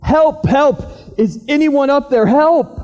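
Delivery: high desperate shouts into the dark; man hanging on a branch calling for rescue, frantic shouting; yelling for help. Acted. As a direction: cry for help from a cliff. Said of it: "And he started yelling".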